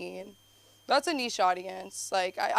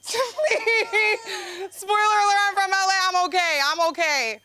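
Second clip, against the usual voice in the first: high, louder, hammed-up crying; fake-sob it, high and loud; mock wail; 12 semitones higher, 5 dB louder than usual. Mock crying about parents.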